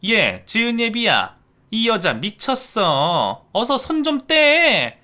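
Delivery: loud, high, slow shouting to her son. Acted. In-law shouting to her son for help. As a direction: call out to your son for backup. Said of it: "목소리를 더 높이더군요".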